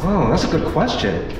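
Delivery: usual speaking voice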